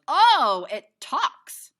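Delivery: high, loud startled cry; startled kid. Acted. Boy startled that the stranger speaks, high and loud. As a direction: blurt it out in shock. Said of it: "and he goes".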